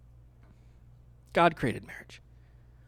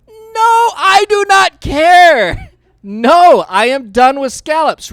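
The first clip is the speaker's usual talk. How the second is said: much higher, louder, slow no; emphatic refusal; 12 semitones higher, 14 dB louder, slower than usual. Acted. Telling chefs he's done with scallops, loud refusal. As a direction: plant your feet, refuse outright.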